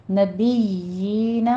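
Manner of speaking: نبیین with the یا held two counts, lips flat; recited نبیین with a long یا; long yaa. Shown as a demonstration. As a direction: draw out the یا in نبیین. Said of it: "یا کی sound آئے گی۔".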